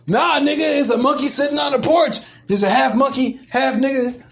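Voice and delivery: high, insistent mock rebuttal; high-pitched protest. Acting out a comeback to a racism accusation, high mock protest. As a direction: argue back high in pitch. Acts out retort to racism charge.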